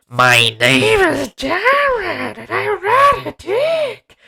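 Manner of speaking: very high, slow squeaky put-on voice; mocking a ridiculous film line, squeaky and slow; silly high voice. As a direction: squeak it out slowly like a cartoon. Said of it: "It's like".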